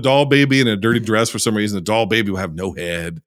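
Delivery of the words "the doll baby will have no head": low, soft creepy storyteller hush; spooky voice. Pretend. Podcaster teasing a creepy doll, low soft spooky voice. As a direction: sink the voice low and soft, horror teaser. Describing creepy horror movie girl.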